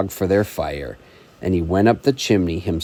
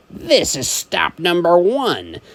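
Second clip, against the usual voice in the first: higher, slow sly Grinch; hissed line; 8 semitones higher than usual. Acted. Grinch sneaking to the first house, hissed. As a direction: hiss it like a sly thief.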